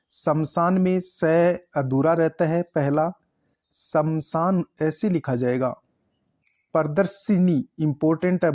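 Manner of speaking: ordinary delivery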